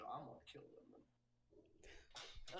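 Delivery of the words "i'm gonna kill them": muttered threat. Retold or imitated. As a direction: whisper it like a vengeful toddler. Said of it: "She goes".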